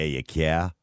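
teasing imitation of an Australian "car"; mock Aussie twang on "care". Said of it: "I can hear the Australian twang".

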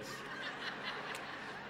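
a quiet, airy chuckle; soft breathy laugh. Real, not acted. Pastor stumbling over names, soft laugh. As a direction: laugh it off under your breath.

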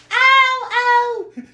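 very high, drawn-out ow; hammed-up high "ow"; mock pained cry. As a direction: imitate a little girl yelping. Voices girl being hurt by monster.

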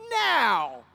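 long drawn-out, falling "now"; mock demanding cry. Imitating ad customers who want their money, demanding. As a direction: stretch it out like a commercial catchphrase. Mimics settlement commercial's demand.

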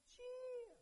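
squeaky, very high mock peep; a tiny half-hearted call; mock timid call. Blind men calling to Jesus, mock timid. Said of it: "they're like".

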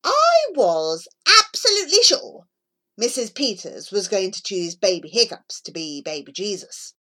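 ordinary delivery

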